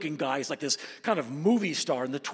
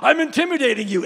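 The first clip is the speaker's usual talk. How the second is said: mock tough cop; 9 semitones higher, 12 dB louder than usual. Mocking authority flexing uniform.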